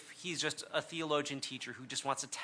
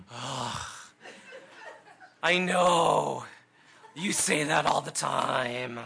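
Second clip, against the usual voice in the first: groaning, bored daughter drawn out slowly; bored eye-roll; 3 semitones lower, 4 dB louder, much slower than usual. Retold or imitated. Daughter tired of hearing dad say he loves her, bored and drawn out. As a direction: sigh it like a fed-up eight-year-old. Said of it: "she just rolls her eyes".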